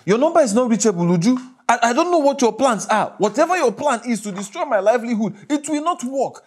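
loud, mounting fury at an absent wife; angry shouting. Acted. Furious phone message to absent wife.

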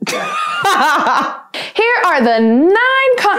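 giggling through the restart; laughing mid-line; breaking character giggling. Real, not acted.

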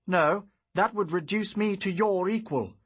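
loud, high defiant retort; defiant outburst. Acted. Hero defies his father.

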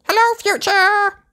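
very high, squeaky little piglet voice. Acted. Little Daddy Pig greeting the future, squeaky and high. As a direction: sound like a tiny child on an old tape. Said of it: "What a squeaky little voice you have".

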